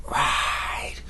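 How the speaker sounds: hushed, breathy skeleton command; ghostly command. Acted. Skeleton whispering a spell over the sofa. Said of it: "skeleton said".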